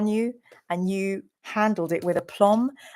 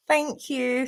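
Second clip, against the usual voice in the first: usual speaking voice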